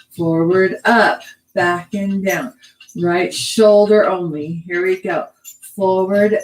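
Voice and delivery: measured, rhythmic counting; dance cueing to music. Shown as a demonstration. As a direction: call each move evenly on the beat. Cues moves to the music.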